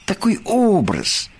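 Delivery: high, stretched poet's protest; exasperated artistic justification; indignant explaining. A narrator's reading. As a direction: explain it with wounded insistence, pitch climbing. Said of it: "возмутился Бараш".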